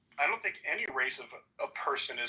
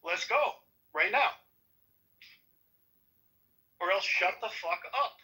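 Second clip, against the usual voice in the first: angry challenge; 6 semitones higher than usual. Real, not acted. Challenging rival in argument.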